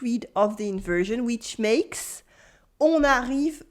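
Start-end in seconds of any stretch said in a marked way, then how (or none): none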